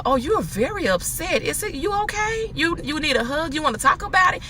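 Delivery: soft and high, gently coaxing; soothing concern. Retold or imitated. To a screaming caller on the phone, soft soothing concern. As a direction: coax them down with a soft concerned voice.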